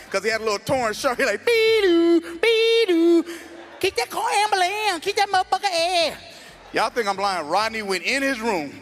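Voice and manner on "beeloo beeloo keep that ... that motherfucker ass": high, loud siren wail then slurred talk; Rodney's mock voice. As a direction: mimic him howling like a siren. Rodney's voice, mimicked siren and slurred speech.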